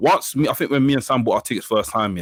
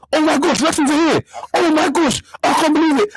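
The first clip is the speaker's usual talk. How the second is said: much higher, squealing excited fan; starstruck fan; 14 semitones higher, 3 dB louder than usual. Retold or imitated. London fan spotting the boys at the party, squealing excitedly. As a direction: gush like you just spotted your heroes. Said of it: "and he was like".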